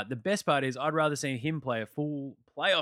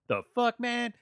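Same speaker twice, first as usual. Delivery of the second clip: high, indignant imitation of LeBron; lightly voiced baffled star; mock LeBron outrage; 11 semitones higher, 4 dB louder, slower than usual. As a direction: throw it back in mock disbelief. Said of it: "And then LeBron's like".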